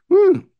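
short, loud, high-pitched "woo"; high whoop. Real, not acted. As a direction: let out a sudden high whoop. Preacher's whoop after a warning.

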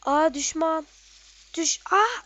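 jolted loud cry at a sudden enemy; startled shout. Real, not acted. Gamer startled by an enemy, loud cry. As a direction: yelp as the enemy pops up.

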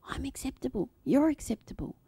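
ordinary talk

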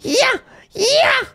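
high squealing yeah yeah; excited squeal. Real, not acted. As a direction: squeal with hope. Chest opening, hoping for key.

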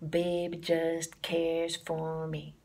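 put-on slow "Baby just cares for me", weight on each quarter note; swung quarter notes. Shown as a demonstration. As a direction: lay every word on the quarter note. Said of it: "the title already is swinging".

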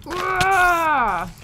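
high loud excited chant; excited cheering. Real, not acted. Excited over game achievement.

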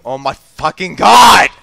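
slow build to a shout; exasperated shout. Real, not acted. Gamer after a failed play, exasperated shout. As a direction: drag it out, exploding at the end. Frustrated at failure.

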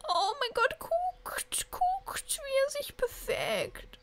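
very high, whispered squeal, slow at the end; squeaky whisper. Real, not acted. About the moving kitten, high whispered squeal.

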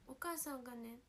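normal talking voice

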